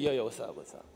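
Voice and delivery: put-on low, quiet, cool-kid greeting; casual street face. Kid flipping back to friends, casual. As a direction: switch to laid-back homeboy talk, softly. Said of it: "And he'd be like".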